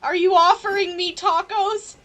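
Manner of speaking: ordinary talk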